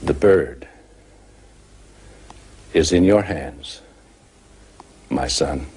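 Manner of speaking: put-on low, measured old sage with long pauses; solemn, low-voiced elder; wise old man. Wise old man answers boy.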